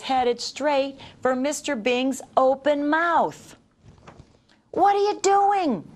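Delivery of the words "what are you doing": high alarmed shout; a startled donut shouting up at the man about to eat him. A narrator's reading. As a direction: cry out in sudden alarm. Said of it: "shouted Arnie".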